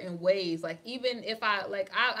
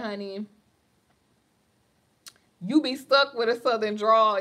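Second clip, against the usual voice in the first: ordinary talk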